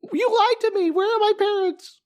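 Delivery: very high-pitched mock child voice; hammed-up squeaky kid voice; squeaky cartoon kid. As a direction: wail it like a cartoon mouse playing a wronged little boy. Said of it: "Is that how he talks?".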